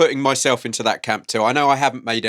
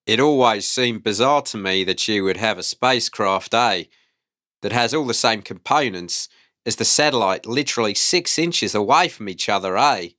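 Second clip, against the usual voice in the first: put-on New Zealand accent; 3 semitones lower than usual. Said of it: "You do the New Zealand accent".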